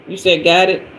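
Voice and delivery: normal talking voice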